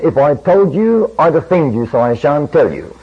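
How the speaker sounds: ordinary delivery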